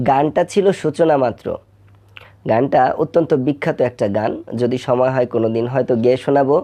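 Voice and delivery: ordinary delivery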